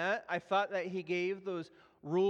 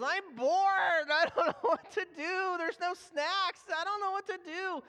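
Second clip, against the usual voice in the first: put-on bored child; 10 semitones higher, 4 dB louder than usual.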